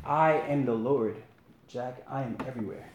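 a hushed divine voice, unhurried; voice of God. A narrator's reading. God calls down to Jack on the cliff, quiet and calm.

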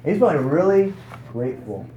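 high, loud burst of mock elation; overjoyed relief. The freed debtor's relief, loud and high. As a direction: play the weight lifting off, big and bright.

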